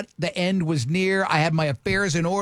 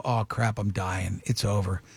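mock panic, low; 10 semitones lower, 3 dB quieter, slower than usual. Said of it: "and I go".

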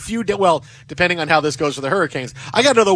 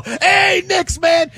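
friendly shout; 11 semitones higher, much slower than usual. Retold or imitated. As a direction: holler a greeting across the store. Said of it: "just go".

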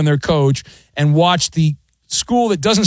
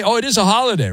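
ordinary talk, nothing marked

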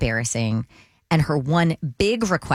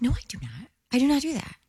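mild denial; 9 semitones higher, 4 dB quieter, faster than usual. Retold or imitated. Acting out her denial to daughter.

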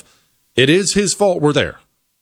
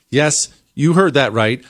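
ordinary delivery

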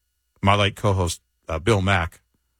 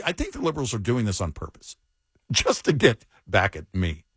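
normal talking voice

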